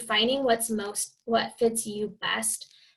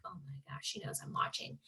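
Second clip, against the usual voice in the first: mock-horrified whisper; hushed, imitated panic; alarmed whisper; 16 dB quieter than usual. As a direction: whisper it like you've been caught. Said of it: "because they're like".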